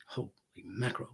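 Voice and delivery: stunned, hushed exclamation; awed whisper. Retold or imitated. Officer stunned by the fiance's beauty, awed whisper. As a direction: whisper it quickly, knocked back.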